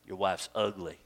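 deadpan the insult as the rude parrot; lightly voiced cheeky parrot, low and soft. Parrot voiced in told joke.

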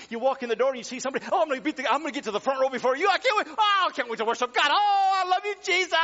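fast, high and loud, bubbling over; eager worshipper. Pretend. Pastor voicing an eager churchgoer, breathless excitement. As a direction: gush like you can't wait for church. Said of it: "those that are saying".

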